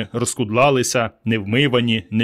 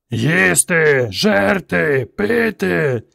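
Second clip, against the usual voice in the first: thundering mob; 5 semitones higher, much slower than usual. Acted. The ape mob thunders for food and drink. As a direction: bellow like a hungry ape mob. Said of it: "гриміла юрба мавпоподібних".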